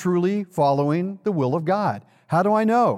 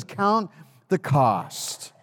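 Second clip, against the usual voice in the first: slow and weighty; much slower than usual. Acted. Jesus's command, slow and emphatic.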